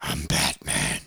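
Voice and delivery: low, slow rasp; hushed hero growl; Batman. Shown as a demonstration. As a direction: growl it in a hoarse superhero whisper. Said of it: "you have to say it like".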